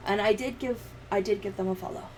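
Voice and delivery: ordinary talk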